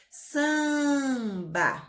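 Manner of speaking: "Samba" with a long-held "Sam"; stretched syllable. Shown as a demonstration. Teacher repeating the example word, "Samba" drawn out. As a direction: stretch the start of "Samba", then a short "ba".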